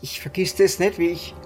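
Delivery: ordinary talk, nothing marked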